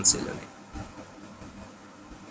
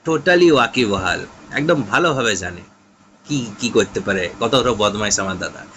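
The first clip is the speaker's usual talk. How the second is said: ordinary talk, nothing marked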